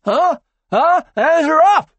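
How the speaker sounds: high, loud insistent huh; aggressive prodding. Acted. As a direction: goad him, jab by jab. Joker keeps jabbing.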